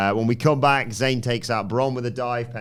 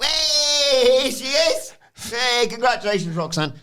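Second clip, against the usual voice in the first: very high, loud cheery shout; mock gleeful greeting; 5 dB louder than usual. [name] greeting Roxanne in front of Dom, high gleeful shout. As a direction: hail her like a party host.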